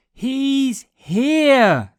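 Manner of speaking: high, loud joyful shout; excited shout. A narrator's reading. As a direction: shout it out with joy. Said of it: "they shouted".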